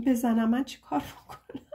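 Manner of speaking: normal talking voice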